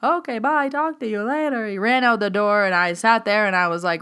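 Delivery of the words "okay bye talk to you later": rushed goodbye. Retold or imitated. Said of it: "He's like".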